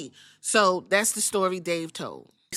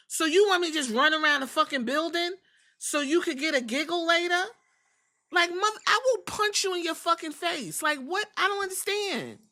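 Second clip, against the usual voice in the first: higher-pitched disbelieving rant; fed-up, half-joking threat, voice climbing higher; incredulous outrage; 8 semitones higher than usual. Real, not acted. Ranting about being hazed.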